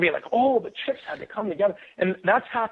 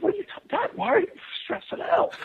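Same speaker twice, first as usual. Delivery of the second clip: high mocking kid voice, mildly teasing; light mock of the teasing kids. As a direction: poke fun at Dad in a high, squeaky voice. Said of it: "my kids are making fun of me".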